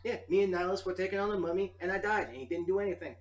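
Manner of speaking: high, loud, fast accusing; loud tattling. Acted. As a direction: rat out your friend loudly. Nick Dick tattles, loud fast.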